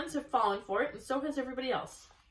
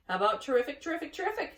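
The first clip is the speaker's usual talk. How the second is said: high honking repeats; goose voice; 6 semitones higher, 5 dB louder, faster than usual. A narrator's reading. Geese suggesting a slogan, honking high. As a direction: honk the word like a goose.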